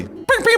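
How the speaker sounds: very high, loud imitation zaps; playful pew noises. Host signing off the interview, playful high pew sounds. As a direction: fire off high toy-laser pews.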